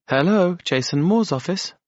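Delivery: receptionist voice. Acted. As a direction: answer the phone as a polite secretary. Receptionist answers the phone.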